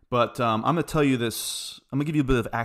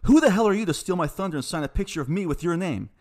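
raised, louder retold challenge; reading out a furious quote, higher and louder; angry confrontation; 6 semitones higher, 6 dB louder than usual. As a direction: throw the accusation back at him, heated. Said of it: "I basically said".

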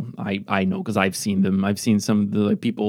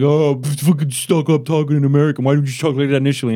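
mock annoyed listener; 3 semitones higher, faster than usual. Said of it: "And then they'll be like".

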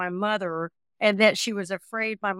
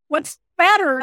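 higher and louder, worried husband calling out; husband's alarmed question; 9 semitones higher, 10 dB louder than usual. Retold or imitated. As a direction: ask loudly what's wrong, alarmed. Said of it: "My husband's like".

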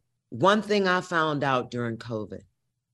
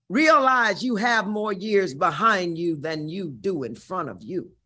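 ordinary delivery

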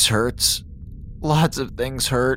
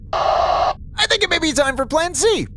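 higher, more urgent, on edge; rising alarm; 11 semitones higher than usual. Acted. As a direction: let the worry climb up. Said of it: "time to panic".

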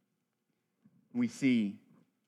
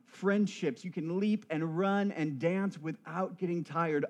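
higher, building list of joys; rousing preaching; 4 semitones higher than usual. Real, not acted. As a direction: pile up each promise with energy. Preacher lists the glory to come.

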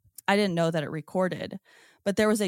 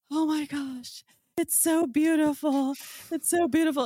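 high, wonderstruck gushing; mock-awe, pitched up and marveling; awed gush; 8 semitones higher than usual. Watching the orb glide behind the tree, high awed gush.

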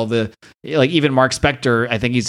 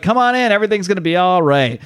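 cheerful Gina; 6 semitones higher than usual. Acted. Gina invites them into diner.

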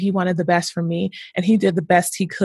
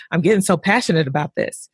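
ordinary talk

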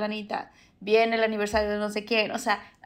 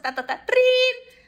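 much higher and louder, sudden bright switch; mock ring and chirpy greeting; 13 semitones higher, 4 dB louder, slower than usual. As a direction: chirp the ring then greet the friend with fake delight. Acting phone ring, cheerful greeting.

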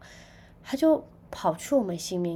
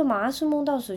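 alarmed report; 7 semitones higher, 5 dB louder than usual. Retold or imitated. Telling mom about the snake dream.

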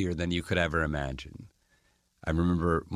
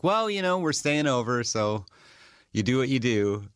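breezy shrug; 5 semitones higher than usual. Retold or imitated. Joking reply to attendants.

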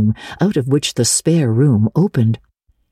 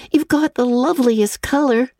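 put-on a higher, friendly girl voice; lightly voiced girl Diana; 10 semitones higher than usual. A narrator's reading. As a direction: pass on a compliment sweetly.

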